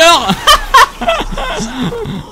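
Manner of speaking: a high, breaking burst of laughter; gleeful high cackle; high giggle. Real, not acted. Laughs after finisher.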